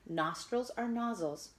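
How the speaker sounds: usual speaking voice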